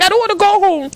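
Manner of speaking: high retold whine; mock tearful. Employee refusing manager's order to leave, high mock-tearful whine. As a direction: protest in a high, mock-crying voice. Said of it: "I'll be crying".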